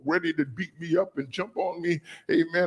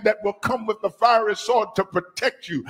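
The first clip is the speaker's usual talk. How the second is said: usual speaking voice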